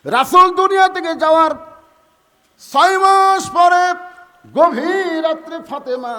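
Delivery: high, loud, drawn-out narration in bursts; full-force preaching. A narrator's reading. Preacher telling of the night six months later, high proclamation. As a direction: proclaim it with long held syllables.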